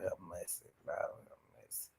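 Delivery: breathless whispered lord have mercy; barely voiced, shocked under the breath; whispered prayer. Real, not acted. Host reacting to the aunt's outburst, whispering in disbelief.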